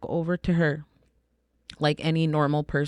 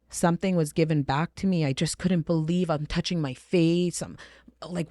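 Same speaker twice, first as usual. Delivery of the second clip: ordinary talk, nothing marked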